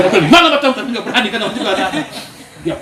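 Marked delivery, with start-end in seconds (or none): 0.3-0.7 high, loud retort with a laugh; the child throws the question back; mock yell. Child imagines yelling back. (balasan anak yang berteriak sambil tertawa)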